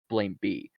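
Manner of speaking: ordinary delivery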